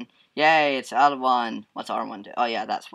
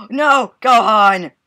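real alarm, louder and higher; alarmed cry; 9 semitones higher, 11 dB louder, much slower than usual. Real, not acted. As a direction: cry out as the teammate drops. Teammate Gohan falling in battle.

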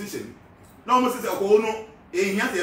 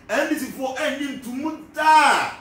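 raised voice, DAA loud and drawn out; slow, higher line landing hard on DAA; emphatic DAA; 4 semitones higher, 4 dB louder, slower than usual. Real, not acted. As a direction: stretch DAA long and loud, pitched up. Mimics party's constant blanket objection.

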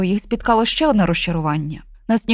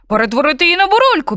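fast, louder barking tone; dog yaps in; 7 semitones higher, 5 dB louder, faster than usual. Acted. Dog chimes in with a fast angry yap. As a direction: snap it out quickly. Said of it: "підгавкнув пес".

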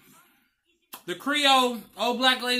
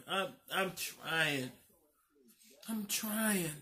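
tearful; 4 semitones lower, 12 dB quieter, much slower than usual. Real, not acted. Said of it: "But I'm so upset".

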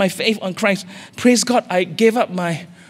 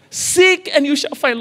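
lifted higher, ringing out the verse; proclaimed scripture; 8 semitones higher than usual. Acted. Preacher proclaiming scripture to the congregation, raised and ringing. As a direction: proclaim it like holy writ.

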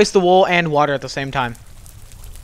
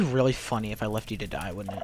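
teasing villain; 7 semitones lower, 13 dB quieter than usual. Real, not acted. Teasing low threat.

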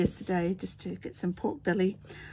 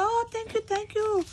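imitating delighted grocer; 5 dB louder than usual. Grocer answering her New Year greeting, imitated very high. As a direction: copy the grocer lighting up. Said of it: "And she just go".

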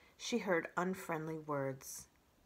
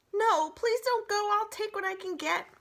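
pleading whine; 14 semitones higher, 7 dB louder than usual. Acted. Fox begs.

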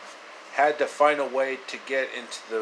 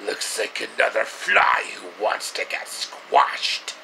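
breathy, gravel-free menacing sneer; villain taunt; 2 semitones lower, 4 dB louder than usual. Retold or imitated. Villain taunting the heroes, breathy menacing sneer. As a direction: taunt the hero like a cartoon brute.

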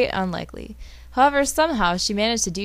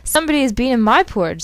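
a hint of a motherly bear, a bit faster; lightly voiced Mama Bear, quick and a touch higher; 4 semitones higher, 5 dB louder, faster than usual. Mama Bear finding her porridge touched, lightly voiced. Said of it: "said Mama Bear".

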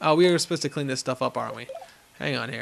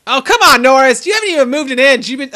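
exasperated, much higher and louder, line 94 fast; angry frustration; 8 semitones higher, 11 dB louder than usual. Real, not acted. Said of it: "I'm sorry to be angry at you".

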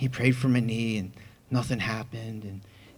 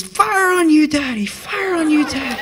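put-on high, loud little-girl shout; Chloe; 8 dB louder than usual. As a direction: imitate an excited small child cheering on dad. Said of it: "my little girl Chloe goes".

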